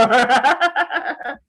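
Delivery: high burst of laughter; real laughing burst. Real, not acted. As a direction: crack up. Laughing at own story.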